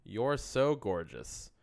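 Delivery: ordinary talk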